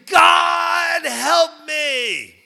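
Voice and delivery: loud, high cry for help; desperate scream. Retold or imitated. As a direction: plead with God at full voice. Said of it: "I screamed".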